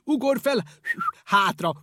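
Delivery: high, loud, friendly driver's shout; a jolly truck driver hollering back; cheery driver call. A narrator's reading. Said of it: "mondta a sofőr fütyürészve".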